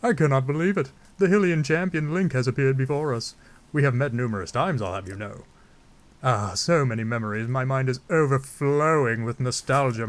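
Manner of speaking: grand monarch, amazed and effusive; King Dorephan. Acted. Zora king reunited with Link, grand amazed voice. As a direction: declaim with swooping, theatrical wonder. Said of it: "I don't know why I'm giving him that voice".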